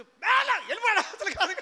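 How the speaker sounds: sudden loud shout breaking into a laugh; king shouting. Acted. King yells at the drummers to go away, loud and laughing. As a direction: yell at the musicians to clear off, then laugh.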